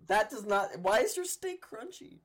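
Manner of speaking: loud high disbelieving outcry; incredulous. Real, not acted. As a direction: demand it in shocked disbelief. Baffled by crunchy eating.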